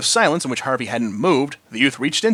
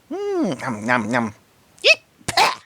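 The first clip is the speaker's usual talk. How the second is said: munching then sputtering; much slower than usual. A narrator's reading. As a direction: chew happily, then spit it out. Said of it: "she sputtered".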